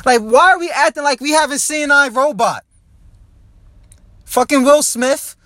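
loud, high, heated rant with a slowed, shouted punchline on Fucking Will Smith. Real, not acted. Ranting alone in his car about robots, loud shouting. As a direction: yell it out like the world is ending. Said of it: "I apologize for screaming out loud".

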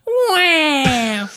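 high loud fake baby cry; mock baby wail. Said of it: "most disturbing thing I've ever seen".

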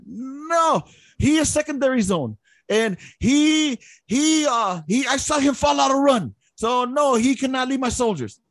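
put-on high Vietnamese-accented verdict. Sergeant major telling first sergeant Lopez can't lead, Vietnamese accent.

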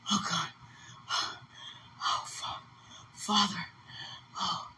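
faint moans. Real, not acted. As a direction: barely breathe the words out. Faint moaning after crying.

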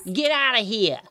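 high-pitched Boston accent imitation; seal's Boston accent. Storyteller imitating a talking seal's guttural Boston accent. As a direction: mimic a talking seal in thick Boston. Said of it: "he would say things like".

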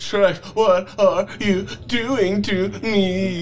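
hammed up, struck by a whip; mock whimpering plea; pleading victim voice. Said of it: "I say in between the stings".

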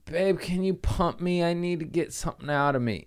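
slightly hammed-up small pleading voice; imitating partner's plea. Acts out partner's plea for help.

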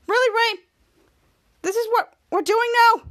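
put-on exasperated scolding; acted indignation. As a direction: confront him in mock disbelief. Kid Danger confronting Ray.

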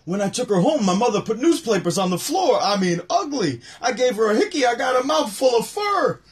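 lightly doing Rodney; Rodney joke voice. Retold or imitated. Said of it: "Rodney do it".

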